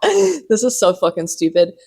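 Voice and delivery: giggling through the words; laughing. Real, not acted. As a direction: laugh at yourself as you say it. Laughing at self.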